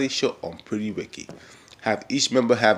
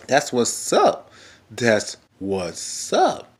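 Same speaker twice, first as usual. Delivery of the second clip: slow approving drawl; much slower than usual. Real, not acted. Slow drawn repeat.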